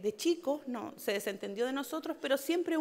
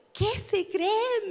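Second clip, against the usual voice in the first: son's voice, David; 8 semitones higher, 4 dB louder, much slower than usual. Retold or imitated. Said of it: "me dijo".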